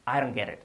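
I DON'T GET IT run into "I-ron geri"; "I don't get it" with flapped d and t; linked pronunciation. Shown as a demonstration. Teacher gives correct version, linked I DON'T GET IT.

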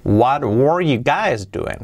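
slow, clearly paced demo of "What were you guys doing"; teacher's drawn-out example; slow model sentence. Shown as a demonstration. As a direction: model it slowly for learners. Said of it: "Fijaos, sería".

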